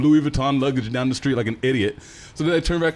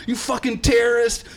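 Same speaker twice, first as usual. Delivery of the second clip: put-on high furious yelling old man; imitating the old man cussing him out, high and furious; angry old man; 9 semitones higher than usual. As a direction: scream it like an enraged stranger. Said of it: "He's like".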